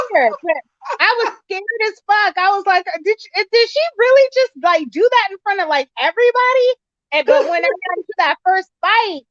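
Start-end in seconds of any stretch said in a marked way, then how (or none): 3.0-6.7 shocked disbelief. Retold or imitated. Retold shock at the fight.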